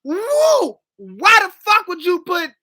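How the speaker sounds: a real, loud outburst of disgust; exasperated outburst. Real, not acted. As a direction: rant it like you can't believe anyone would do that. Outraged rant about sweet chili.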